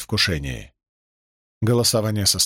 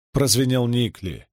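plain reading voice

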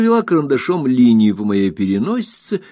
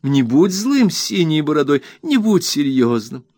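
high pleading, stretching the end; high wheedling plea to her husband; pleading wife; 6 semitones higher than usual. A narrator's reading. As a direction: wheedle him out of his mood, up high. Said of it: "воскликнула Дора".

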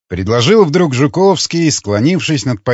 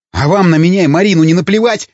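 fast, louder and higher angry bark; barked retort; 6 semitones higher, 3 dB louder, faster than usual. A narrator's reading. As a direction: snap it back at them in fury. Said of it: "рявкнул Ломака". (рявкнуть быстро и громче)

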